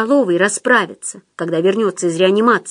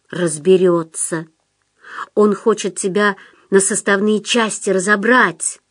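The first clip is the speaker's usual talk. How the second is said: usual speaking voice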